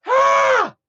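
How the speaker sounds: very high, loud short scream; sudden high-pitched imitation scream; imitated scream. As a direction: shriek like her, acting it out. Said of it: "and she screams".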